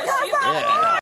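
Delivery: high, loud gleeful voicing; mock gleeful yeah. Voicing the man on screen, high and gleeful. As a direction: ham up his delight. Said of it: "He's like".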